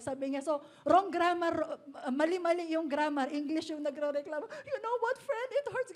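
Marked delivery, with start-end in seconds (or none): 4.5-5.9 high, mock-tearful complaint; hurt friend. Pastor imitates a crying friend tired of grammar corrections, mock-hurt and high. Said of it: "umiyak siya".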